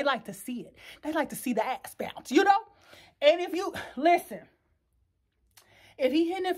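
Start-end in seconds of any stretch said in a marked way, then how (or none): none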